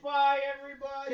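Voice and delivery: high, very loud stretched farewell; shouted goodbye. Real, not acted. Guest leaving the stream, high shouted goodbye. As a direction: yell bye to the whole crowd.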